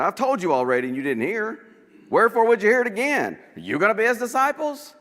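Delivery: high, loud mocking challenge; sarcastic retort. Blind man mocking the Pharisees with high loud sarcasm.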